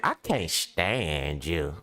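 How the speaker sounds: low, slow and deadpan, pretending dislike; mock hostile line. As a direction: drag out the words like a cool brush-off. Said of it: "That's they compliment".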